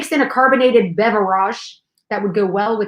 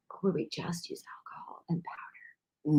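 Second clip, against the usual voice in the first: hushed, voiceless scheming aside; conspiratorial whisper; 6 semitones lower, 17 dB quieter than usual. Pretend. Co-host floating alcohol instead of water, scheming whisper. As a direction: whisper it like a naughty idea.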